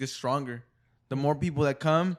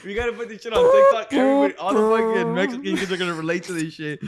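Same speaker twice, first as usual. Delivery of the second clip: high, loud, laughing excitement; worked-up hilarity at full volume; excited riff; 7 semitones higher, 9 dB louder than usual. Real, not acted. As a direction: get carried away, loud and high. Excited riff on late-night commercials.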